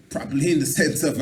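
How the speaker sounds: ordinary delivery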